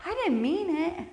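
high, innocent-sounding excuse; pleading excuse. Acted. Churchgoer excusing their own slip, high mock pleading. Voicing our excuse for ourselves.

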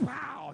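loud high punchy pow; sound effect pow. Retold or imitated. Preacher acting out flipping the goat, loud pow. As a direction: bang it out like a snap.

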